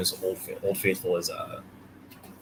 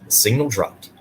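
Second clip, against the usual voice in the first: ordinary delivery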